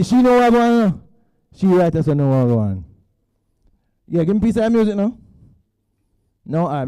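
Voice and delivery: usual speaking voice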